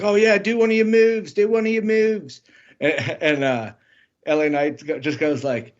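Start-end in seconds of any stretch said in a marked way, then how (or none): 0.0-2.4 higher, eager mock hype-man voice; hyped backstage guy. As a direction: imitate a bouncy guy egging him on. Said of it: "bouncing around and he's like".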